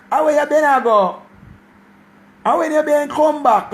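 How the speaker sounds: put-on high, bright child voice in broad patois; little girl patois. Remembering herself as a little girl, high child voice. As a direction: squeak it out like a small Jamaican girl.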